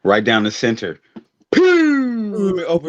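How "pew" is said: high zapping pew; a quick cartoon laser pew; pew sound effect. Cutting the drum open with a noise.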